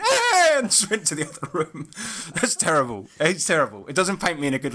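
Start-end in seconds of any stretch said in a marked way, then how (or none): 0.0-0.6 very high, louder disgusted grunt; a sulky kid's huff; huffy child grunt. Retold or imitated. Uncle imitating his niece storming off with a high huffy grunt. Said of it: "And she went".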